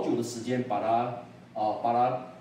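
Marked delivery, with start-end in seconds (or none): none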